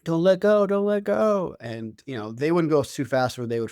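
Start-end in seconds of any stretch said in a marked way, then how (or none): 0.0-1.6 put-on high, loud panicky squeal of a child; hammed-up scared kid squeal; mock squealing kid. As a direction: imitate the kids begging dad on the bike. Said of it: "a lot of squealing".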